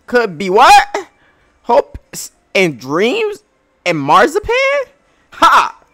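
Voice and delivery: loud, high, slow mocking voice; mocking crewmate. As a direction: scoff at a silly idea. Voices another game character.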